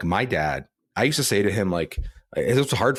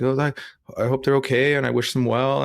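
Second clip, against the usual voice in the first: lightly voiced, higher, breezy well-wishing; mock polite sympathy; 7 semitones higher, 4 dB louder than usual. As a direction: toss off hollow sympathy for someone else. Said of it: "about someone else".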